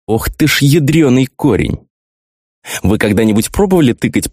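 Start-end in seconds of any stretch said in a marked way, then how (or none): 0.1-1.9 high, slowed dismayed outburst; shocked exclamation. A narrator's reading. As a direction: gasp it out in disbelief.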